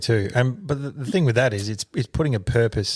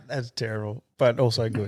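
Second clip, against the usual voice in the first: laughing; 4 dB quieter than usual. Real, not acted. Laughing at the accent.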